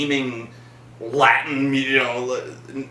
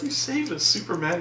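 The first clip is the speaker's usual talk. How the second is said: much softer hushed swoon; mocking Lois thanking Superman, very soft; mock adoring Lois; 4 semitones higher, 7 dB quieter than usual. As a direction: sigh it out in mock adoration. Voicing Lois.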